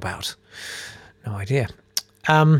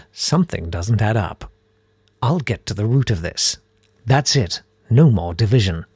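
mock superhero catchphrases in a low-key voice; David Alt; 4 semitones lower than usual. Maths hero's catchphrases, poor impression. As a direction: deliver catchphrases in a not very good impression. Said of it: "That's my impression of David Alt".

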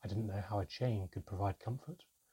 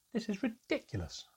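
much higher-pitched complaint; exasperated sigh; 12 semitones higher than usual. A narrator's reading. Narrator complains at a voice nobody can see, exasperated. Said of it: "I sighed".

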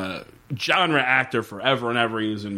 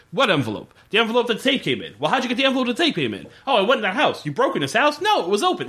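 rapid mock phone dialogue; 8 semitones higher, much faster than usual. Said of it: "terrible conversations they have on the phone".

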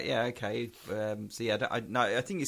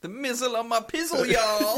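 much higher, louder hammed-up rapper; mock rapper; 12 semitones higher, 10 dB louder than usual. Riffing on the mizzle story to make the co-host laugh, hammed-up. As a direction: ham it up as a rapper.

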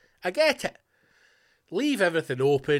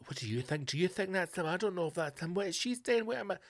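puzzled viewers murmuring; 3 semitones higher, 7 dB quieter than usual. Acted. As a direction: softly fret like confused TV viewers. Mimics puzzled viewers talking during drama.

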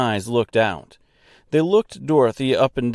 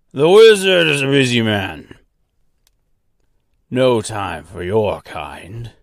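throaty brush-off from a strange doorkeeper; throaty, gruff; 5 dB louder than usual. Acted. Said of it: "throaty and gruff".

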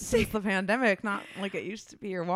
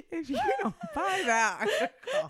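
laughing; 11 semitones higher than usual. Retold or imitated. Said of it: "I said".

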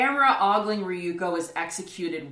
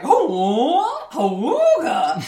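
loud, high cartoon leer; a slow goofy wolf-whistle awooga; ogling awooga; 9 semitones higher, 8 dB louder, much slower than usual. Camera ogling her.

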